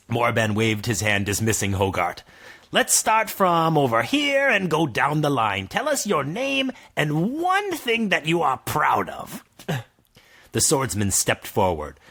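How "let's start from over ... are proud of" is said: wizard voice. Acted. Morban addresses group.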